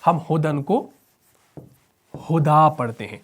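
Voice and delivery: usual speaking voice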